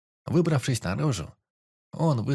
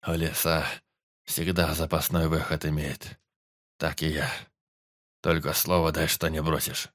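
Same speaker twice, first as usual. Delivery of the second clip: weak forester; 5 semitones lower than usual. A narrator's reading. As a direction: ask as if barely able to see him. Dying forester voiced.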